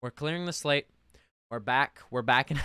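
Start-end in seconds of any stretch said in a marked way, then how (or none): none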